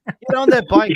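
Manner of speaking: loud and high, pushing them on; rallying shout. Real, not acted. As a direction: call it out like a cheer. Rallying cry.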